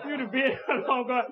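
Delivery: ordinary talk, nothing marked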